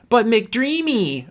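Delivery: drawn-out, high McDreamy, mocking; mocking nickname. Real, not acted.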